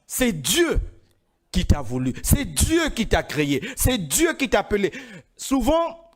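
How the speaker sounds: ordinary delivery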